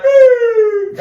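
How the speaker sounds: high squealing mock siren beep; imitated siren beep.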